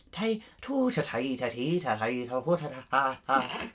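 nonsense syllables in an Irish lilt; Irish gibberish. Pretend. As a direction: babble with only the Irish rhythm. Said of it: "It's not even saying words".

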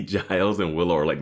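ordinary talk

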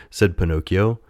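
usual speaking voice

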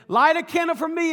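high, loud shouted request; calling out. Retold or imitated. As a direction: holler a favour after someone leaving. Friend calls request to leaving woman.

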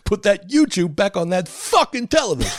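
hammed-up tantrum yell; mock furious demand. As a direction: play an enraged child demanding the screen. Acting an enraged child's demand.

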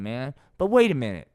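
ordinary talk, nothing marked

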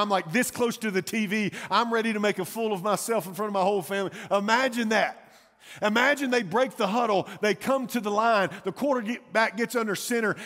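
loud, high, worked-up game-day excitement; excited fan. Acted. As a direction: build the suspense like a fan glued to the game. Acting out tense last play of game.

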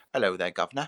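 mock accent on a stock British greeting; Hugo's accent. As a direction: put on a hammed-up British accent. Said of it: "Do your boyfriend Hugo's accent".

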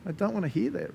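ordinary talk

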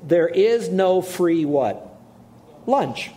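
slow build, pause, high "Lunch"; slow lead-in, then a high punch on "Lunch"; fill-in-the-blank pause. Shown as a demonstration. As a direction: let them finish the phrase. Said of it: "audience fill-in".

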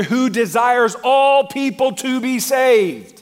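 slow, high, loud climax; drawn-out pulpit cry; preaching shout. Real, not acted. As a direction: stretch each word out at full volume.

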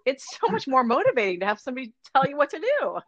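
high, gushing rush of realization; excited self-talk. Retold or imitated. Coach recalls talking to herself in her own workouts, excited.

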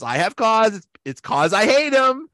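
high, exasperated protest; mock outburst. Fan quotes boss demanding to fire Toby, exasperated. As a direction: burst out with petulant loathing, pitch climbing. Boss's outburst acted from episode.

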